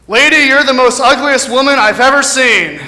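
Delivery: parrot. Retold or imitated. Parrot insulting a lady in the pet store, loud squawky jeer. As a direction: call it out brashly as a parrot. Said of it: "And the parrot says".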